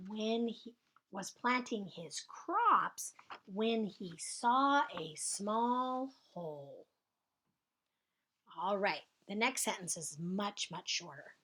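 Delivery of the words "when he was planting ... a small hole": slow dictation. Shown as a demonstration. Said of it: "rereading the dictation".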